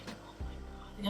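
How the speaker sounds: barely voiced, slow mutter; exasperated breath of a whisper; fed-up whisper. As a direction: whisper it, worn out by him.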